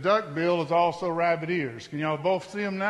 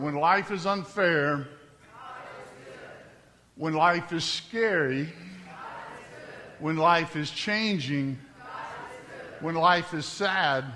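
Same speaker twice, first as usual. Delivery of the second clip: slow recital; slower than usual. Acted. Reciting VBS theme slowly.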